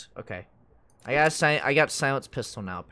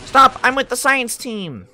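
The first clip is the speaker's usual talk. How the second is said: shout; 12 semitones higher, 9 dB louder, slower than usual. Acted. Shouting at a game guard.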